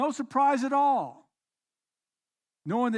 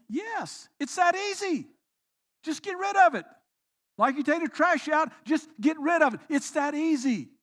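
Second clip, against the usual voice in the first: higher, emphatic reply; insistent Paul; 5 semitones higher than usual. Pretend. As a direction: brush the doubt aside as Paul.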